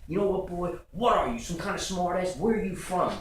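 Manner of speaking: high, indignant interrogation; pissed-off game warden. Retold or imitated. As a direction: act out an angry warden confronting a smart aleck. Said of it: "He's pissed".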